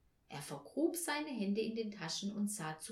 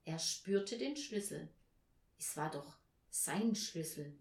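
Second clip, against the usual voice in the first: ordinary delivery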